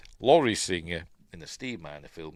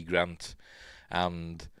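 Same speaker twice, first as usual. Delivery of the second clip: usual speaking voice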